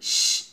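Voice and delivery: শ as a long voiceless hiss. Shown as a demonstration. Teacher models the sound of shin, hissing শ. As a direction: let only air out on a sustained শ. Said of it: "এভাবে শব্দ করেন".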